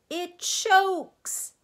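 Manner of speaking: very high, loud gleeful shout; joyful shout. A narrator's reading. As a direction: shout it jumping for joy. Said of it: "he shouted again".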